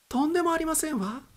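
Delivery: a courteous stewardess brushing it off; high, genteel attendant reply; polite flight attendant. Acted.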